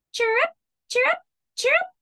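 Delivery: very high, fast chick squeaks; squeaking chicks. Acted. Baby chicks squeaking in the nest, very high fast chirps. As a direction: chirp like tiny baby birds. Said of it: "squeaked the baby chicks".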